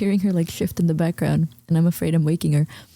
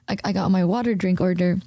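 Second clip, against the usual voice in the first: ordinary talk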